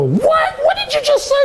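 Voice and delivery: incredulous laughing "what"; imitating a woman testing him; shocked woman. Imitating women's shocked reply.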